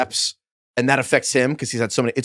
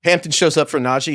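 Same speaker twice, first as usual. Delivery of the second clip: ordinary delivery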